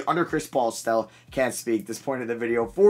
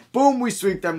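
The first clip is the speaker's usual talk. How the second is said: higher and louder, thrilled; excited; 12 semitones higher, 7 dB louder than usual. Real, not acted. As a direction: cheer the sweep. Celebrates a playoff sweep.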